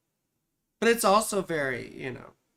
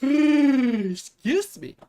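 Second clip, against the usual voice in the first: heavy drawn-out sigh before excusing herself; 8 semitones higher, 7 dB louder, much slower than usual. Real, not acted. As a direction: let out a long weary sigh. Sighs, then excuses herself.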